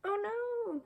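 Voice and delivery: high gasping alarm; dismayed oh no. Real, not acted. Reading to children as Mouse runs away, high alarmed oh no. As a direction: cry out in sudden worry.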